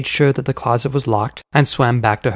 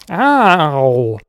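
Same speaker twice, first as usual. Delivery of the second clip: sharp yelp; 3 semitones higher, 5 dB louder than usual. A narrator's reading. Flicked on the head.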